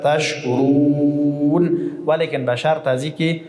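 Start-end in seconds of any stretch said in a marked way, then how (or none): 0.0-1.8 تشکرون sung slowly, the و stretched six beats; six-beat madd. Shown as a demonstration. Teacher to class, chanting تشکرون with a six-beat و. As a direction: draw out the و of تشکرون as long as you can. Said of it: "شش حرکت".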